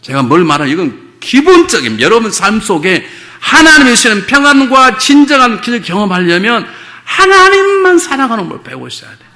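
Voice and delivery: loud, high, driving sermon voice; preaching at full force. Acted. Pastor urging the congregation to love God, loud and high. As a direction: press the point home to the whole church.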